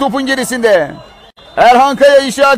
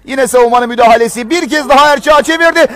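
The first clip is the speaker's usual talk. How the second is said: ordinary talk, nothing marked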